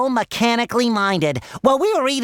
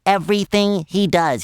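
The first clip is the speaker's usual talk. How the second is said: usual speaking voice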